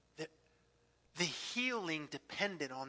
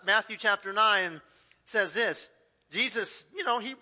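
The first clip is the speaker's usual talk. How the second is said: usual speaking voice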